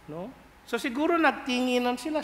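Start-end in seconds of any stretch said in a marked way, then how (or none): none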